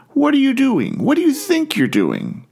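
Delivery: acted-out demand for an explanation; confrontational professor. Professor confronting a student who finished late, indignant.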